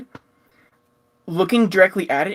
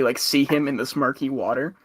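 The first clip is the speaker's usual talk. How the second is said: ordinary delivery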